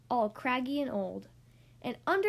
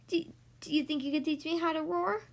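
rushed mouse plea; 2 semitones higher, faster than usual. Acted. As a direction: blurt it out in one breath. Mouse asks quickly.

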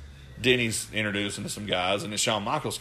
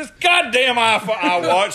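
much higher, louder flustered cry; exasperated outburst; 12 semitones higher, 9 dB louder than usual. Retold or imitated. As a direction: blurt it out in frustration. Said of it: "I said".

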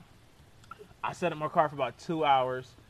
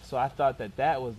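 ordinary talk, nothing marked

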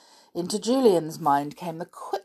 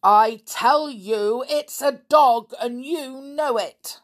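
loud, high, slow insistence; snapped; 8 semitones higher, 7 dB louder, slower than usual. A narrator's reading. Stepfather insisting the children know about the dog, snapping. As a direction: hammer each phrase out in temper. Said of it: "snapped Mister Lenoir".

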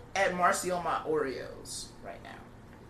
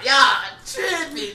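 outraged shout; 12 semitones higher, 13 dB louder, much slower than usual. Real, not acted. Mock outrage at freeloading.

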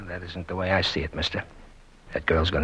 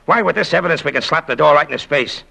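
loud, high triumphant burst; triumphant outburst; 5 semitones higher, 8 dB louder than usual. Acted. Investigator gloating to the wife about evidence, loud and triumphant. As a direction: crow loudly over the win. Agent crowing over evidence.

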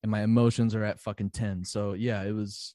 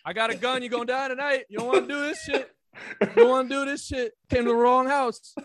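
mock tough threats; 10 semitones higher, 4 dB louder than usual. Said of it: "I always talk shit to people".

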